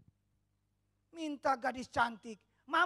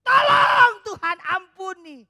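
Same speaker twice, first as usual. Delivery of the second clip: loud slow cry for help, rising high; Petrus cries out; 5 semitones higher, 20 dB louder, slower than usual. Retold or imitated. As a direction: cry out to God as you sink. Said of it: "Petrus teriak".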